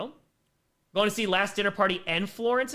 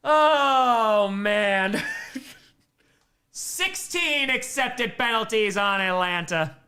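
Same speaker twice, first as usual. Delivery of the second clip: loud, higher, slow disbelief through a laugh; amused disbelief; 5 semitones higher, 5 dB louder, much slower than usual. Real, not acted. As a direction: marvel at the penalty count. Reacting to penalty pile.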